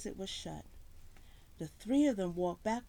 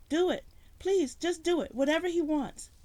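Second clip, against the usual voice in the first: pleading; 10 semitones higher, 9 dB louder than usual. Acted. Wife begs lover to pay up.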